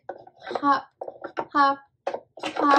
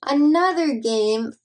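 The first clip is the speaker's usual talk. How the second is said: ordinary delivery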